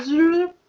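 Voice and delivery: high, loud cartoon zap; squeaky zoop noise; zap sound effect. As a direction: zap it out like a cartoon ray gun. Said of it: "I was like".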